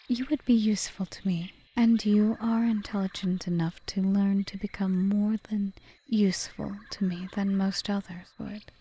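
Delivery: usual speaking voice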